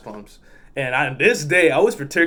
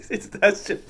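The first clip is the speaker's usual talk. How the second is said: cracking up at own story; laughing; 6 semitones higher, 4 dB quieter than usual. Real, not acted. Teller recalling driver's insult, really cracking up. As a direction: break into real laughter, words squeezed through. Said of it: "I was laughing so much".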